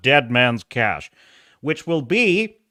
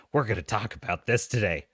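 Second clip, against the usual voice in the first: low, softer assurance; subdued promise; 7 semitones lower, 8 dB quieter than usual. Pretend. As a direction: drop down and promise it quietly. First, subdued take of the promise.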